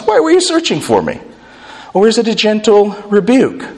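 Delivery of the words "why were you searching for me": put-on defiant teen. Shown as a demonstration. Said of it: "typical adolescent inconsideration and defiance".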